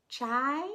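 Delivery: Chai said "chy" with a rising question; a child offering "Chai?" in Swahili; Swahili word. Acted. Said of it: "said in Swahili".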